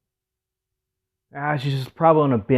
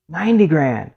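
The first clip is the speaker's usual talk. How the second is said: raised, loud bid calling; auctioneer; 5 semitones higher, 5 dB louder than usual. Retold or imitated. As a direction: call out the rising bids to a crowd. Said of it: "the auctioneer goes up".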